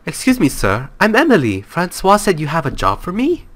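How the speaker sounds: put-on high-pitched, eager young job seeker; game heroine. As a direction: voice a polite character asking, pitched well up. Voicing a game character's dialogue.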